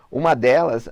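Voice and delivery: ordinary talk, nothing marked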